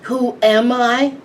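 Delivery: monster roaring. A narrator's reading. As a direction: bellow the question like a giant tree-monster. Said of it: "still roaring".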